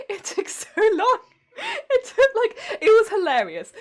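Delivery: giggling through the words; real laughter breaking into the words; laughing. Real, not acted. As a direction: laugh while you tell it. Laughing while telling phone story.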